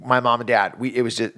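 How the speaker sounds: normal talking voice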